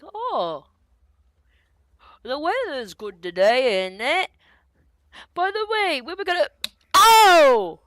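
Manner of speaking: high, loud, falsely casual chatter ending in a yelp; big dumb bandit blurting. Acted. Dim bandit chatting up the party, then a loud oops. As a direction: play innocent, then catch yourself with a loud oh. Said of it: "one guy kinda looks at me and goes like".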